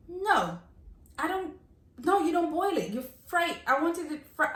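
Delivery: high-pitched, halting objection; acted exasperation, pitched well up; indignant protest. Customer objecting that plantain gets fried, indignant protest.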